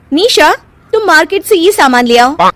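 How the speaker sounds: high-pitched, lightly bossy relative; bossy sister-in-law. Acted. Kirti sending Nisha to market, a touch bossy. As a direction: order a junior about, mildly.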